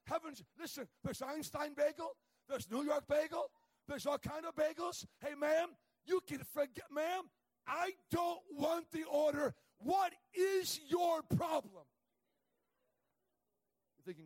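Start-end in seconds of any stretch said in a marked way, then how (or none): 0.0-11.8 loud, high, slowing shout; mock angry outburst. Preacher imagining telling off the cashier, loud slowing shout. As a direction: rant at her and drag out the end. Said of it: "I wanna say".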